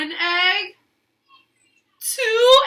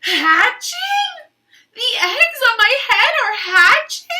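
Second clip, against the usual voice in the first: loud, higher disbelieving cry; shocked Elephant; 8 semitones higher, 10 dB louder than usual. Acted. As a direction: Elephant can't believe it's hatching.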